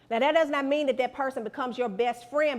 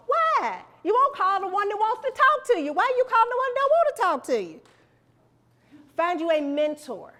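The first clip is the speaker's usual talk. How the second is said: exasperated; 9 semitones higher, 5 dB louder than usual. Real, not acted. Exasperated about prank-calling students.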